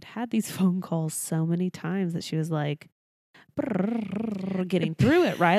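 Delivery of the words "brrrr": rapid rolled "brrrr"; rattled-off motormouth noise. Retold or imitated. Imitating mom racing through phone calls, rapid trill. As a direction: trill as if speeding through a script. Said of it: "was going through that so fast".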